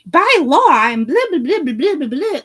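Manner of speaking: high, loud mocking singsong; mocking imitation. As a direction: parody them with blah blahs. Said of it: "And y'all like".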